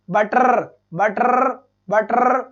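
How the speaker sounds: "बटर" ending in a long trilled R; teacher's exaggerated trill closing "बटर"; rolled final R. Shown as a demonstration. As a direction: roll the end of "बटर" hard. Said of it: "यहाँ से ये अर्र की sound आती है".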